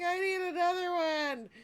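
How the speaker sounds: playful mock whine; mock needy voice. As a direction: whine that you need another. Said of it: "I was like".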